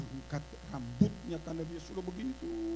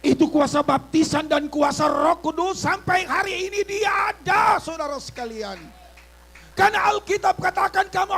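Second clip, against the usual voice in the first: loud, high, shouted sermon climax; full-force preaching; 10 semitones higher, 15 dB louder than usual. Acted. Pastor thundering at the congregation, loud preaching. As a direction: preach it out at the top of your voice.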